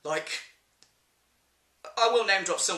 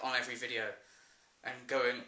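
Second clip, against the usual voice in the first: ordinary talk, nothing marked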